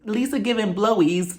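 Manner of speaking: laughing through the words; amused repeat of a funny phrase. Real, not acted. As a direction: giggle the phrase out. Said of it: "I just think that the word is funny".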